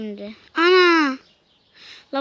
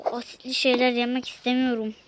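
ordinary delivery

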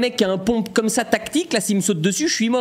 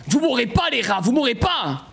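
high, indignant cry at enemies; exasperated outburst; 5 semitones higher than usual. Real, not acted. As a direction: cry out at foes who refuse to die. Joueur crie sur les rats. (cri aigu d'exaspération)

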